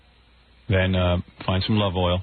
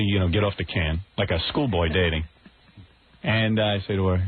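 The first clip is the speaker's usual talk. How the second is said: usual speaking voice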